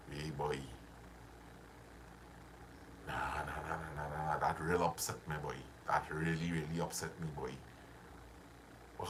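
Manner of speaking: low, soft, sulky grumbling; upset brooding grumble. Shown as a demonstration. Showing his listeners how a negative state sounds, low sulky grumble. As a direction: mutter it like the grudge is eating you. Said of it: "you're in a negative state right now".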